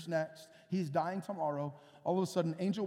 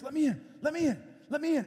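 urgent plea; 6 semitones higher, 4 dB louder, faster than usual. Retold or imitated. Peter urgently knocking at gate.

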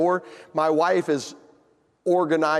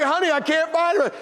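high, insistent call; calling back; 10 semitones higher than usual. Retold or imitated. Husband telling wife the morsels are missing, hollering.

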